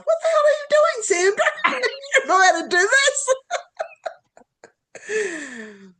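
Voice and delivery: very high, squealing, laughing through the words; mock yourself in disbelief, cracking up; laughing self-mockery.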